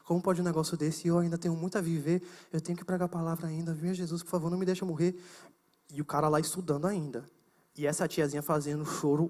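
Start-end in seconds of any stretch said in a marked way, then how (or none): 0.0-5.2 lower, soft, pleading murmured prayer; hushed prayer. Retold or imitated. Scared student praying to Jesus while hiding, hushed plea. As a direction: pray under your breath, begging. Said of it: "E eu aqui orando".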